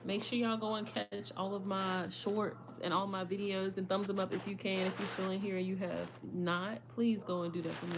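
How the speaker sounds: usual speaking voice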